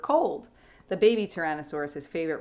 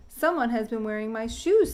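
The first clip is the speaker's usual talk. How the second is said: higher, louder grumpy papa dinosaur; 5 semitones higher, 5 dB louder than usual. Acted. Papa finding his shoes moved, grumpy. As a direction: grumble like a cross father. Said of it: "said the papa in his grumpy voice".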